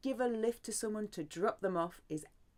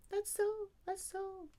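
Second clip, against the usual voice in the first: playful; 13 semitones higher, 3 dB quieter than usual. Acted. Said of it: "light playful aside".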